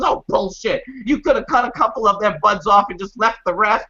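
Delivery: high-pitched mock scolding; angry grandma. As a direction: imitate grandma heatedly arguing back. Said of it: "my grandma was hot".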